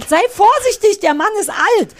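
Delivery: loud, high warning call; warning shout. Retold or imitated. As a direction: shout it at the screen in alarm. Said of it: "am anderen Ende gesagt hab".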